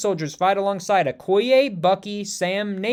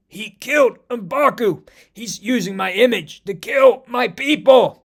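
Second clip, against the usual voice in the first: choked out; 5 semitones higher, 5 dB louder, slower than usual. Acted. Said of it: "T'Challa chokes out".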